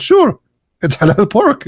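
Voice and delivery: high, sing-song "Sure"; fake-sweet tease; mock reassurance. Teller teases friend.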